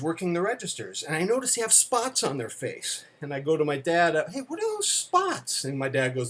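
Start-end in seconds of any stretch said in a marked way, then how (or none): 4.3-5.6 very high squeaky mock kid voice; amazed child. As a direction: imitate a little boy marvelling at his dad. Retelling child's question to dad.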